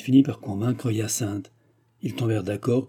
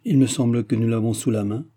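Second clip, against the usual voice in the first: ordinary delivery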